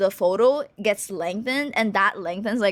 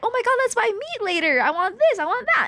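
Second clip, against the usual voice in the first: excited mom; 12 semitones higher, faster than usual. Retold or imitated. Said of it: "She's like".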